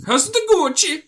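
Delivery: high, exaggerated Italian accent. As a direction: shout it like a cartoon Italian. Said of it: "Why are you doing racist Italian accents?".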